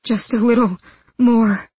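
dazed, needy craving; mumbled plea. A narrator's reading. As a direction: beg with a halting pause. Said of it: "the Grisha mumbled".

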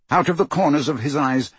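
plain reading voice

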